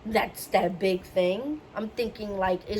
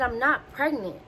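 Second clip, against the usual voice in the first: firm, higher-pitched stress on "not"; insistent denial; 4 semitones higher than usual. Retold or imitated. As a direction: retell an exasperated, emphatic denial. Repeats denial after being doubted.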